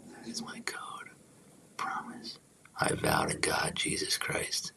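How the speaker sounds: hushed, breath-only promise; very soft, solemn whisper; whispered vow. Real, not acted. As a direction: whisper it like a private oath.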